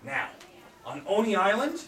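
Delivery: ordinary delivery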